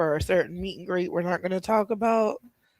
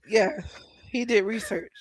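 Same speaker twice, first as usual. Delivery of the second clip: ordinary talk, nothing marked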